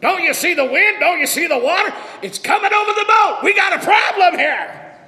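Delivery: building from fretful protest to a loud panicked shout; panicked disciples yelling. Retold or imitated. Disciples shouting to Jesus over the storm, building panic. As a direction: yell it like men in a sinking boat. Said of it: "you're like".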